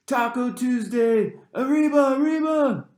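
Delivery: high, loud, slow taunting chant; mocking cheer. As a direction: whip up the cool kids with a jeering yell. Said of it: "he added this one to rouse them".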